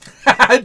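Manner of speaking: loud, high laugh; burst of laughter. Real, not acted. Game master laughing that Jacob is gone, real loud laughter.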